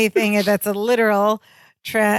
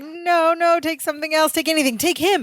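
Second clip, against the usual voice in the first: mock panic; 7 semitones higher than usual. Larry panicking, offers Balky away.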